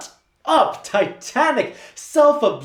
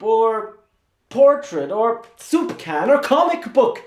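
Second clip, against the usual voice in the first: louder and higher, climbing to a yelled question; building furious tirade; 6 semitones higher, 4 dB louder than usual. Acted. Assistant berates the painter, building to a high furious yell. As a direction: let the rant build to a scream.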